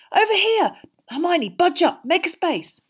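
high, loud, urgent calling; excited boy calling out in a squeezed high voice; urgent hiss. A narrator's reading. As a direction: beckon someone over eagerly. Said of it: "Ron hissed".

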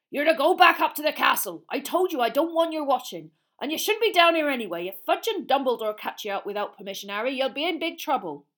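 loud, rough half-giant ordering them away; Hagrid's gruff voice. A narrator's reading. Hagrid sending the children back to the castle, loud and gruff. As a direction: boom it out like a big upset gamekeeper.

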